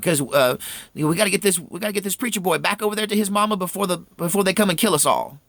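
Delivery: high, rushed fearful pleading; fast, frightened urgency; terrified. Retold or imitated. Mother needing the boy home, fast frightened plea. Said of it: "She was genuinely terrified".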